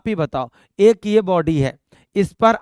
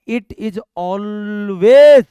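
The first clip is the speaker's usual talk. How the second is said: "always" drawn out long and loud; stress on always; 6 dB louder, much slower than usual. Real, not acted. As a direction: stretch out "always" with a big swell at the end. Emphasising a key rule to students.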